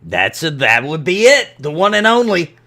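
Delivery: dwarf salesman building to a loud, high finish; confident dwarf. Acted. Dwarf confirming the legendary prize to the adventurer, confident. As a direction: confirm it grandly, rising in volume. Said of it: "he is very, uh, confident".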